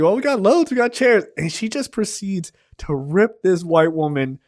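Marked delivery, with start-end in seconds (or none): 0.0-1.2 eager clerk. Retold or imitated. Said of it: "he's like".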